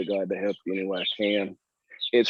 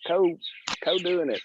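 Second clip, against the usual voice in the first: eager, higher mock-excited quote; retold excitement; 8 semitones higher than usual. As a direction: replay how thrilled we were. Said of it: "We were like".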